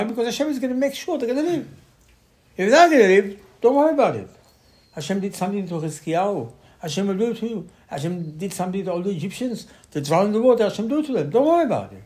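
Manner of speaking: high, forceful retold argument; impassioned acting-out of a debate; emphatic rebuttal. Acting out Caleb arguing with the people.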